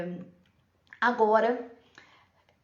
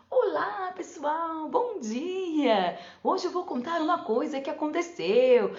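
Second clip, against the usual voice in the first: high, chirpy puppet greeting; a child voicing a puppet character, pitched up; 7 semitones higher, slower than usual. Shown as a demonstration. Child voicing puppet Tio Zé greeting the class, high playful voice. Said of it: "ela vai ter que fazer uma voz que não é a dela".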